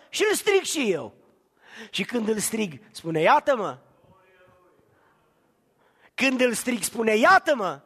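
fervent pulpit cry; preaching at full force. Acted. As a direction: drive the congregation to the peak.

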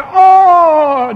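high, loud crying shout; anguished cry. Retold or imitated. Preacher voicing Jesus' cry on the cross, loud crying shout. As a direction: let out a wordless cry of pain. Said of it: "De ce în loc de toate acestea?".

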